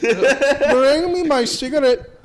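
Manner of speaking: put-on Middle Eastern sheik accent. Friend copies the sheik line slowly in a loud Middle Eastern accent. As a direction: drag out the order in a sheik accent. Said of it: "that was a sheik".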